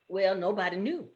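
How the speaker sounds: plain reading voice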